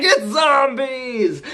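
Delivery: high, slow gleeful villain announcement; spring the surprise with relish; hammed-up high reveal.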